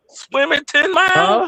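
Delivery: high, slow disbelieving question; incredulous. Real, not acted. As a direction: ask it like you can't believe it. Incredulous at the swimming claim.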